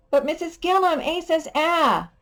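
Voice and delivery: high whiny student; child voice. Pretend. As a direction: protest like a little pupil. Voicing a student objecting.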